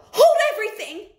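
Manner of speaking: high, loud witch shriek. Acted. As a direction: shriek it across the ballroom. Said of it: "Her shrieking voice echoed".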